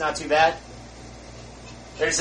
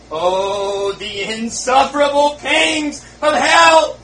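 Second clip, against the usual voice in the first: loud, high and slow cry of agony; cried out; 8 semitones higher, 8 dB louder, much slower than usual. Acted. Dying sinner's last words, a slow loud agonized cry. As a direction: wail it slowly in torment. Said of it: "he cried out".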